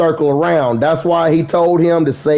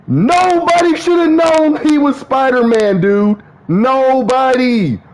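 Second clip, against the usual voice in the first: worked-up rant; 10 semitones higher than usual. Real, not acted. Venting to viewers about a movie scene, high and exasperated. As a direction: stretch out Nobody in disbelief.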